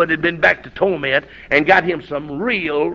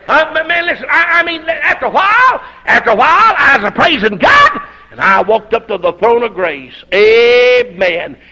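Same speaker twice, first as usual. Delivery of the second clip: rising, higher and louder preaching; full-force preaching; 6 semitones higher, 3 dB louder than usual. Real, not acted. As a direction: build the sermon up to a cry. Preaching builds to a shout.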